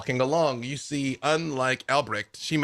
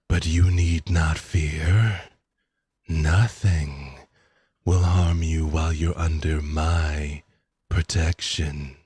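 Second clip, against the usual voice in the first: low, slow, looming voice heard in the head; dark lord speaks into their minds, low and slow; ominous voice in minds; 7 semitones lower, 4 dB louder, slower than usual. Acted.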